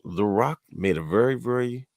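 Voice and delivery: ordinary talk, nothing marked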